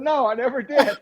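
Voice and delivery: laughing high through the words; laughing reply. Retold or imitated. Retelling his own reply to Baffert, laughing through it. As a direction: answer while cracking up. Laughing retort in retold story.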